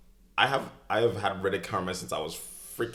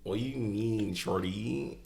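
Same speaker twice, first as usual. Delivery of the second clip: higher, put-on Toronto slang accent; 4 semitones higher, slower than usual. Co-host answering that he sounds like a Toronto man, hammed-up Toronto accent. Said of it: "you sound like you're a Toronto man".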